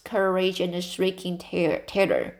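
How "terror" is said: ordinary delivery, nothing marked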